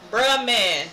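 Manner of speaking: loud fed-up groan; exasperated shout. Real, not acted. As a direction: blurt it out loud, annoyed. Complaint at wrong floor.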